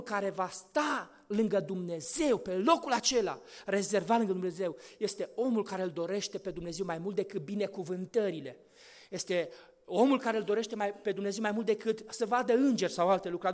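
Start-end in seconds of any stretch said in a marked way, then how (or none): none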